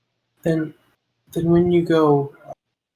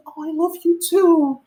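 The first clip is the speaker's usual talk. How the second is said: put-on much higher, doting baby-talk voice; adoring child. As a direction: coo it lovingly at the pet. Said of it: "And she's like".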